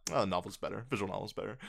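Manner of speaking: low, quiet imitation of a stock complaint; dismissive quote. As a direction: toss it off under your voice. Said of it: "where I'm like".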